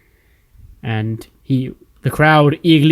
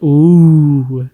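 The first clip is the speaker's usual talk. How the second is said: mock ooh; 6 dB louder than usual. Cesaro mock-impressed reaction.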